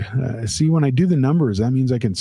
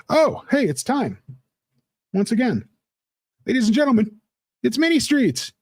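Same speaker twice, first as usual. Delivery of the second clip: announcer; 10 semitones higher than usual. Pretend. Announcing the character to chat.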